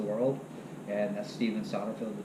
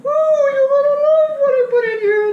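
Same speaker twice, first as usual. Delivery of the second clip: very high, loud put-on chef voice; squeaky celebrity chef impression; Julia Child voice; 16 dB louder than usual. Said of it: "I went".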